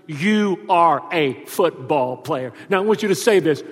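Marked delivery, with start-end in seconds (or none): 0.1-2.5 each word slow and weighty; slow emphatic. Retold or imitated. Father pronouncing identity over son, slow and emphatic. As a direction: lay it on him slowly.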